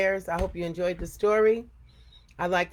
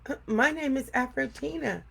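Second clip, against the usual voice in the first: tiny squeak; 7 semitones higher than usual. A narrator's reading. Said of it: "squeak in a tiny voice".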